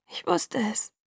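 soft, breath-heavy acted sob; sobbing. A narrator's reading. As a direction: sob it quietly through tears. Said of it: "schluchzte ich". (leise schluchzend gespielt)

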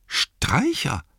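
high, gasped-out disbelief; astonished exclamation. Acted. Innkeeper finally finds his voice, astonished exclamation. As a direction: cry out the name in amazement. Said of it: "rief er aus".

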